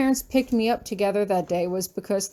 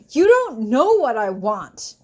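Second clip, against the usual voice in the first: angry retort; 5 dB louder, slower than usual. Acted.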